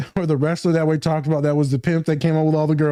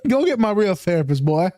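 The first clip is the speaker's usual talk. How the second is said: haughty Southern lady; 4 semitones higher than usual. Pretend. Southern belle bit continues.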